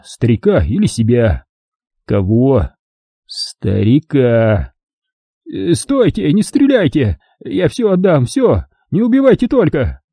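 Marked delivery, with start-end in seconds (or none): 5.5-10.0 terrified pleading. A narrator's reading. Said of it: "Вдруг белугой взревел Вартан".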